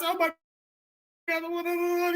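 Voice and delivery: high, drawn-out mock wail; retold crying. Answering the security guard, high imitated crying wail. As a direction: wail it as if in tears. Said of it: "I broke down in tears".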